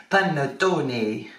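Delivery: hammed-up Italian "Panettone", slow and drawn out; mock Italian, stretching every syllable of "Panettone"; a deliberately bad Italian accent on "Panettone". Shown as a demonstration. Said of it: "That was a really bad accent".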